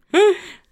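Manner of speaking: loud sharp gasp. Acted. Sudden gasp mid-story.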